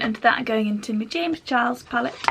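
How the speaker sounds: ordinary delivery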